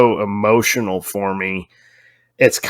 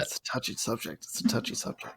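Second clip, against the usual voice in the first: mock-delicate whisper; hushed aside; 18 dB quieter, faster than usual. Co-host teasing after a triggered rant, hushed mock-delicate whisper. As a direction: whisper it very softly, as if tiptoeing round it.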